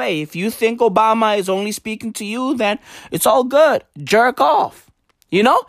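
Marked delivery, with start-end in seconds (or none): none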